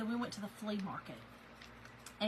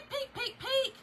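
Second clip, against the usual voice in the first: playful squeak; 14 semitones higher, slower than usual. Pretend. Describing nipple peeking out.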